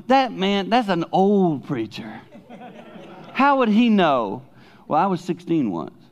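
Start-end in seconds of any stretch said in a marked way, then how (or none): 0.1-4.4 put-on higher, slow, doubting young listener; mock the old preacher as a sceptical teen would; skeptical teenager. Preacher imitating a doubting teen in the pews, higher and slow. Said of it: "you're looking going".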